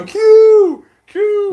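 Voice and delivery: high, drawn-out holler; imitated calling out. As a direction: mimic calling a friend up at his window.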